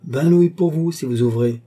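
loud, high, resolute bid; urgent offer. A narrator's reading. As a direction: tempt the soldier with a firm offer. Resolute lady bargains with guard.

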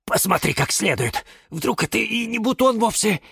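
high, anxious pleading; nervous high-pitched persistence; insistent. A narrator's reading. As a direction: urge a second look, hoping it is a mistake. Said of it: "не унимался кактус Кирилл".

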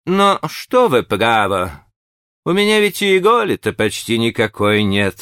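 mumbling burred actor voice; modest burring actor. A narrator's reading. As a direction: shrug it off modestly. Keister modestly replies.

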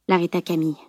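plain reading voice

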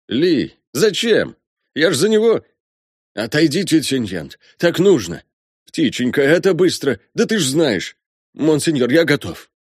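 high, urgent pleading father; distraught colonel. Acted. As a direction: urge them aside, then soothe the child.

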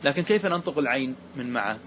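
usual speaking voice

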